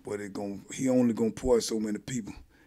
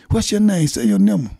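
female officer voice; 5 semitones higher, 7 dB louder than usual. Retold or imitated. Officer demands his number.

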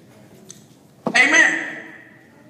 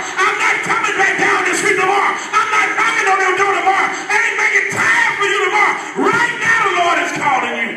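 preaching at full force; 5 semitones higher than usual. Acted. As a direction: press the hearers hard.

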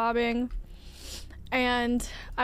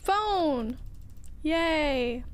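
bright excited cheer; happy little exclamation; 4 semitones higher than usual. Real, not acted.

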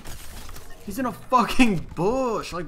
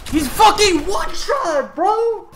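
hyped gamer bro; 9 semitones higher, 7 dB louder than usual. Real, not acted. Hyped kill reaction.

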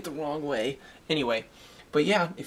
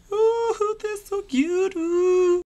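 cooing at pets; 7 dB louder, much slower than usual. Real, not acted. Vlogger coos at his little pet army, high squeaky gush.

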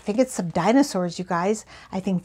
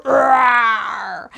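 loud, playful roar in the song; dinosaur roar; 6 dB louder than usual. Acted. Host roars in the song for the children, loud and playful. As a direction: let out a big hammed-up roar. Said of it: "letting out a roar".

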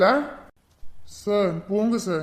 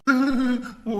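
shy giggle; 3 semitones higher than usual. Acted. Caught teasing, laughs it off.

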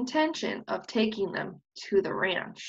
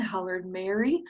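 usual speaking voice

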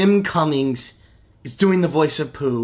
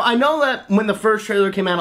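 ordinary talk

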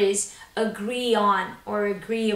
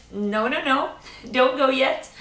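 playful protest; 4 semitones higher, 6 dB louder than usual. Acted. Playfully stopping learners leaving.

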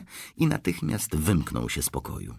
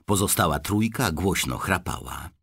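ordinary talk, nothing marked

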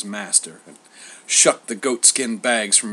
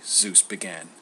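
plain reading voice

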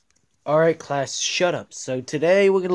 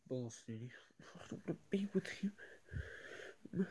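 whisper; 20 dB quieter than usual. Acted. Quarrel between seatmates.

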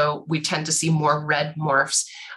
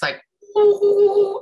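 high, loud bubbly owl trill; gargled screech owl call; 5 dB louder than usual. Shown as a demonstration. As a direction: mimic a screech owl through spit. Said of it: "So it's like this".

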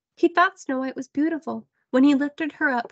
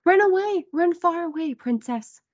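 urgent hunter; 6 semitones higher than usual. A narrator's reading. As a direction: urge her to flee fast.

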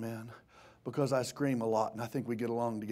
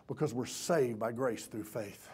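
usual speaking voice

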